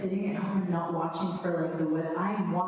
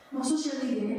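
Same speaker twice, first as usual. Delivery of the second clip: lightly put-on Arabic accent, pitched up; 5 semitones higher than usual. Warning the group about social media, playful Arabic accent. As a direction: slip into a mock Arabic accent. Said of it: "I get Arabic accent".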